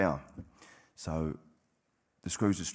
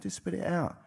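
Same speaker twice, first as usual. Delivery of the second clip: light mimic of the counsellor; 11 semitones higher, 3 dB quieter than usual. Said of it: "and she went".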